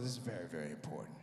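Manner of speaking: hammed-up solemn warning; deep guard voice. Guard stopping comic at masjid door, deep and grave.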